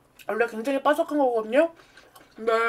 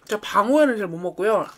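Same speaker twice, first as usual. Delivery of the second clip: ordinary delivery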